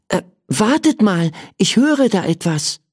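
high-pitched, halting alert; alert interruption. Acted. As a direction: cut in as if catching a sound. Child hears a noise, interrupts. (aufhorchend, mit hoher Stimme)

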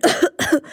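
short high unvoiced cough; child coughing. A narrator's reading. Emil coughs from fairy dust, small high cough.

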